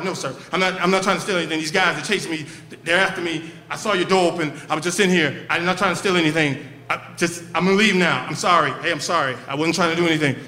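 rapid fire. Retold or imitated. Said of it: "Real quick, rapid fire".

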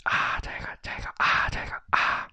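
hushed mumble into someone's ear; whispering. Acted. Attendant whispering in the emperor's ear, hushed. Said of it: "耳语了几句".

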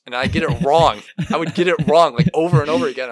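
loud, high exasperated complaint; exasperated outburst. Real, not acted. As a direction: throw up your hands, loud. Exasperated about getting math wrong.

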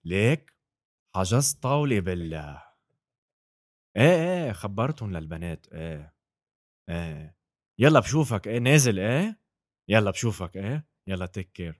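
lightly put-on Lebanese Arabic greeting; casual Lebanese phone chat; Lebanese accent. Shown as a demonstration. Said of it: "the Lebanese accent".

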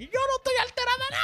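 high, fast mock-furious squeal; comically indignant high-pitched outburst; riled-up woman. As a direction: shriek it like an outraged girlfriend, hammed up. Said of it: "es que más le quilla a ella".

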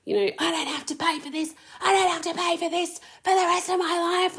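high, dismissive mimicry of her mother; mocking mum voice. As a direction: sneer it out in a put-on high voice. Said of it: "her just being like".

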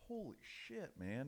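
high, hushed disbelief; acted shock. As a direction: react to an outrageous price, high and soft. Buyer shocked at the price.

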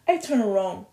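usual speaking voice

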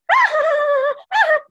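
high, loud burst of laughter; mock laugh at the daughter's worries; imitating mom's laugh. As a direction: copy mom laughing at her worry. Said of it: "empieza a reírse".

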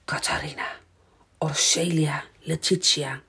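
soft, unvoiced storybook whisper; hushed whisper, name by name. A narrator's reading. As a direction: whisper the names secretively. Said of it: "en fluistert".

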